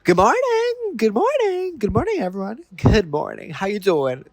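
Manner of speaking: high, singsong mock good-mornings; imitating excited greeter. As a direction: chirp greetings to the whole room like an eager guest. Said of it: "how excited she was to say good morning".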